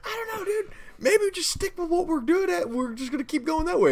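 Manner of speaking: very high, silly worried voice; mock hesitant co-host. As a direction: imitate a reluctant friend in a high squeak. Imitates co-host's hesitant reply.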